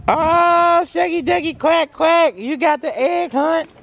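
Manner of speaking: high sing-song cooing. Real, not acted. Dad cooing at his baby who found an egg, high sing-song.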